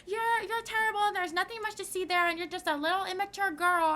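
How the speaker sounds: high mocking mimic of the mom; mocking the woman. Teen mocking the mom from her car window, high sneering voice. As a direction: sneer it high like a spiteful adult. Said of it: "and she was like".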